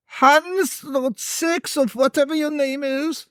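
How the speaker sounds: high, far-off shout as the farmer father; mock shout across the yard at his son; calling out to Hans. Father shouting to his son from the yard, high calling voice. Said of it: "He's like".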